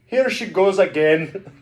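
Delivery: badly faked accent, high and loud; Sebastian. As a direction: attempt a cartoon crab's accent, slowly and loudly. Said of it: "I'm so bad at accents".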